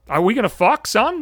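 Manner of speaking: sudden high sarcastic son; mocking jab. Real, not acted. Podcaster mocking the awkward stranger, sudden high jeer. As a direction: needle him with a jeer.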